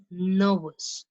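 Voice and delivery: Nervous with the first syllable NER stressed. Shown as a demonstration. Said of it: "say after me".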